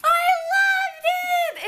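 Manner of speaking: high, drawn-out thrilled cry; excited. Real, not acted. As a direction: burst out with delight. Enthusing about larp.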